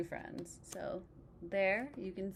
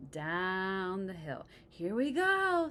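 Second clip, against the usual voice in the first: thrilled; 6 dB louder, much slower than usual. Acted. Sled starts moving.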